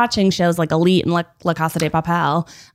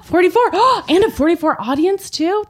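gasping shock; 10 semitones higher than usual. Real, not acted. Shocked at low score.

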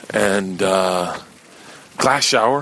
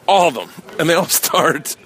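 ordinary delivery

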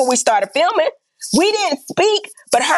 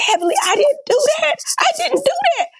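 mock wail, pitched way up; imitated hollering and crying; 14 semitones higher than usual. Friend crying denial to Heavenly on the phone, imitated high wail. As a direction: imitate her high-pitched, tearful protest. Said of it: "She started hollering and crying".

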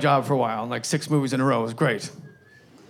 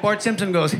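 ordinary talk

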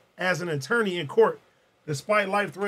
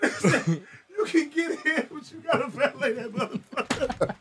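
slow, higher voice through laughter; laughing retelling; 4 semitones higher, 4 dB quieter than usual. Retold or imitated. Host repeating friend's valet joke, laughing as he quotes. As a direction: repeat the punchline while cracking up.

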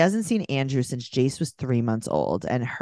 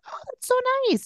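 very high, fast put-on voice for Andrew; mock Andrew voice; faster than usual. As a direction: squeal it like a ditzy fool. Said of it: "He's like".

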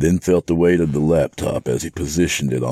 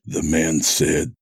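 plain reading voice

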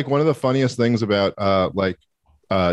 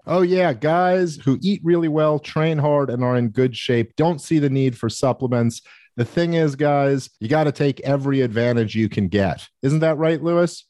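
higher-pitched hammed-up ad pitch; ad read; 5 semitones higher than usual. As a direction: sell it like a cheesy infomercial.

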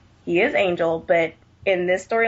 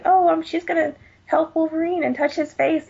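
high-pitched mocking retelling; mock gushing; 10 semitones higher than usual. Fan mocking an uncomfortable romance scene, high mock-gushing.

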